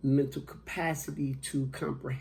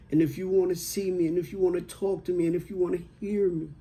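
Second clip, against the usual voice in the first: louder, higher, quick driving repetitions; retold motherly insistence; 4 semitones higher, 5 dB louder, faster than usual. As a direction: press each phrase home as a mother's plea. Mother's urgent last words, retold.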